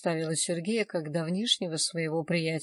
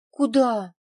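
loud, higher startled query; taken aback; 5 semitones higher, 9 dB louder than usual. A narrator's reading. Man told they are going to grandmother's flat, taken aback. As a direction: ask back sharply, as if you misheard. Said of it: "переспросил Владик".

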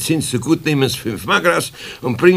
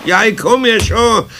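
calling out; 7 semitones higher, 5 dB louder, slower than usual. Acted. Eder calls to the ringing door.

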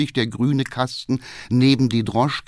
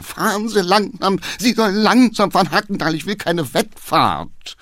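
high-pitched, agitated passenger; alarmed shouting; 8 semitones higher than usual. A narrator's reading. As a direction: shout urgent orders at the coachman. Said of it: "schrie der Geheimrat". (aufgeregt schreiend)

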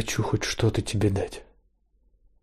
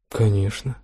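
slightly surprised. A narrator's reading. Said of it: "Тон слегка удивлён сменой темы".

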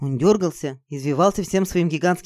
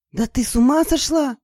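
high-pitched incredulous outcry; astonished; 8 semitones higher than usual. Acted. Izergil reacting to a crazy plan, astonished. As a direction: blurt it out in disbelief. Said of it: "изумилась Изергиль".

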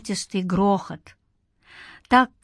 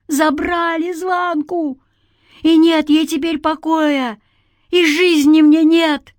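high, anguished outcry, growing louder; breaking into a cry; 8 semitones higher, 5 dB louder than usual. A narrator's reading. Man grieving his stolen girl, breaking into a cry. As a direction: cry it out high, building to full voice. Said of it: "и голос сорвался на крик".

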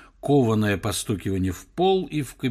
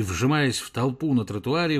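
ordinary delivery

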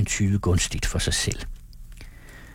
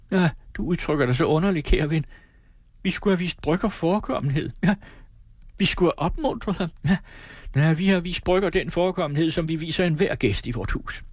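high, quick reproaches; wife correcting her husband; sharp, reproachful wife; 7 semitones higher than usual. Acted. Fru Ram skænder på manden.

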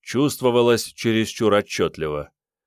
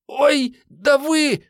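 high squeak, drawn out; squeaky girl; 5 dB louder, much slower than usual. A narrator's reading. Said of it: "пискнула Мариетта".

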